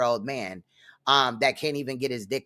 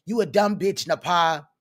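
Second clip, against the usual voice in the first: a raised, mocking quote of Judah's insult; 4 semitones higher than usual. Podcast host quoting Judah insulting Napa, mocking. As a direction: throw the insult back at him, pitched up. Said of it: "Judah came out and said".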